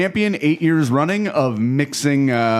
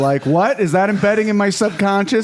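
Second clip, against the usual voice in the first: put-on growing boy; 9 semitones higher, 3 dB louder than usual. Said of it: "just a growing boy".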